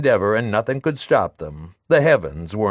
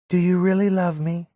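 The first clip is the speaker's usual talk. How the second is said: put-on higher, hopeful young woman; Madelon's voice; 8 semitones higher than usual. Madelon asking Léon about love, higher hopeful voice. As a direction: ask it with a hopeful lift.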